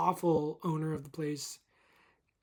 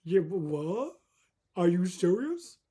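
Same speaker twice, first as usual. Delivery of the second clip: incredulous, looking down on you, slow on serious; a touch of a snobby clerk's scoff; snooty disbelief; 3 semitones higher, 3 dB louder, much slower than usual. Retold or imitated. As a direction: sneer at a dumb question. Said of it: "They're like".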